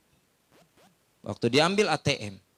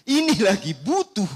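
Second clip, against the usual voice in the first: ordinary talk, nothing marked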